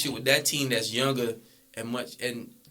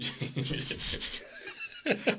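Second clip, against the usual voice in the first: breathy laugh; 8 dB quieter than usual. Real, not acted. Laughing at the Jameson joke.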